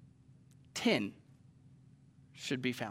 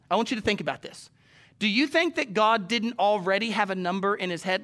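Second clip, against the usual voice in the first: ordinary delivery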